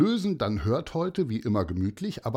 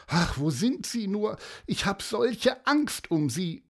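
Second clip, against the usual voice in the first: high, anxious lament; worried mother; 10 semitones higher than usual. Acted. Mother afraid for her lost children, anxious. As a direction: fret aloud, pitch held up.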